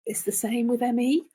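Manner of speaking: usual speaking voice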